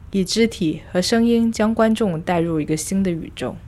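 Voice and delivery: usual speaking voice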